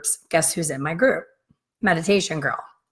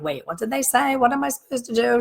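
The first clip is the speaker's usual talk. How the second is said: panicked; 6 semitones higher than usual. Retold or imitated. Imagined panicked self on plane.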